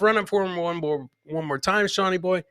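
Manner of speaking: ordinary talk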